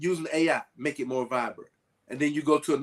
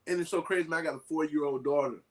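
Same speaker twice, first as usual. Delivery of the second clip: usual speaking voice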